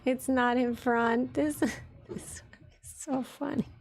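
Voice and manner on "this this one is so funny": breaking up with laughter, voice giving out; helpless laughter. Real, not acted. Streamer finds the model so funny, laughing. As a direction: squeeze the words out softly through laughter.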